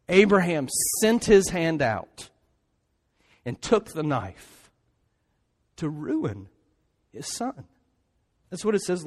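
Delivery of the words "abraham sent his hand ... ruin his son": very slow reading. Shown as a demonstration. Preacher slowing the sacrifice scene for his congregation, slowly. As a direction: let each phrase hang in slow motion. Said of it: "It's so, so very slow".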